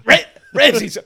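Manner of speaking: ordinary delivery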